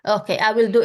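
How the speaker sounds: usual speaking voice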